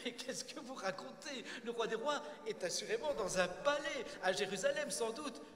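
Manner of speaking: high, loud scoffing disbelief; incredulous interruption. Acted. Nathan interrupting the shepherd's tale, scornfully incredulous. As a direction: scoff loudly at a tall tale. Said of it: "Nathan l'interrompt".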